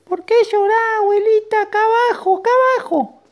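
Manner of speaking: loud, very high squeak; tiny little voice. Acted. Ant calling up to grandma from below, tiny squeaky shout. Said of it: "sentía una vocecita".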